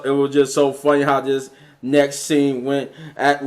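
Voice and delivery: normal talking voice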